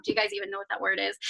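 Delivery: ordinary delivery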